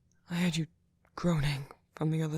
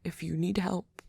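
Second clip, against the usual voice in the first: ordinary delivery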